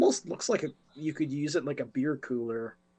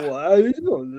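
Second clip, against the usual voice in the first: loud, slurred boxer impression; laughing mock of a dazed fighter; punch-drunk guy; 7 dB louder than usual. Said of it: "like, that's punch drunk".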